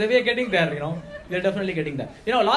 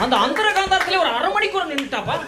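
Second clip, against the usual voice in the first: excited Tambram mama; 9 semitones higher, 3 dB louder, much faster than usual. Retold or imitated. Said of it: "Tambra mamas".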